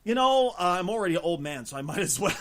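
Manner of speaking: ordinary delivery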